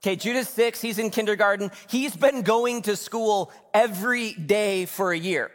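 high-pitched, incredulous imitated complaint; exasperated. Retelling wife's exasperation at son.

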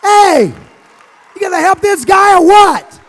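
high shouted call to the crowd; loud rallying call. Real, not acted. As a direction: holler across the room. Calling out to congregation.

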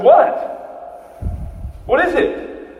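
high, loud, exasperated demand; bewildered snap at their joy; confused and irritated. Acted. Thomas to the rejoicing disciples, high and irritated. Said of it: "And so confused, and frankly a bit irritated".

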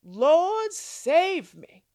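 loud cry for help. Acted. Sinking Peter cries out to his Lord, loud and urgent. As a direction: cry out as you sink. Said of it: "he cried out".

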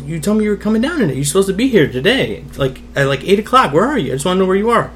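high, pleading retold protest; hurt, insistent kid. Retold kid confronting mother by phone.

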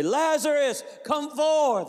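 high, ringing shouted command. Acted. Jesus at Lazarus' tomb calling him out, commanding shout. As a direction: call it out to raise the dead.

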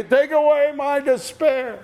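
high-pitched imitation of a plea; mock pleading demand. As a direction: cry out high, as a mock petitioner. Acting out a distant believer's demand.

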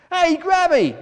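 high, loud indignant call; protesting. A narrator's reading. Gull to the gannet pushing in, protesting. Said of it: "one of them protested".

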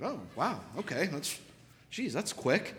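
soft surprised reaction with swooping pitch; lightly acted, hesitant surprise; mildly taken aback. Client reacting to short session.

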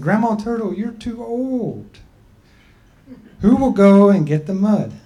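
people's voice. Acted.

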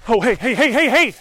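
rising alarmed shouts. Real, not acted. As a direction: warn off a stranger. Startled by stranger following.

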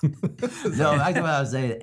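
laughing while talking. Real, not acted. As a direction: chuckle through the words. Laughing at cough joke.

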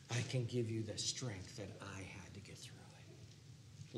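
slow, soft unvoiced hush; whispered promise. Preacher whispering God's strength to the hurting. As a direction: lean in and breathe the promise.

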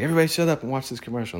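high, fast call for attention; mock urgent hushing. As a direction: imitate a dad hurriedly hushing the kids. Retelling dad hushing the room.

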